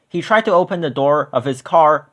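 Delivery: usual speaking voice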